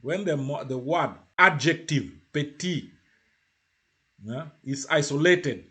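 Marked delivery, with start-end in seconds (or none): 2.3-2.9 PETIT alone, ending on the vowel; silent T. Shown as a demonstration. Teacher shows PETIT as an isolated adjective, silent T. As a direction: drop the last T of PETIT. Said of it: "you don't pronounce the T".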